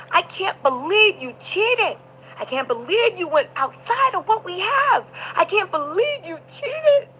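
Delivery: high, drawn-out wounded whine; mock distraught accusation. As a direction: imitate a betrayed lover wailing slowly. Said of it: "He was walking in circles, pacing".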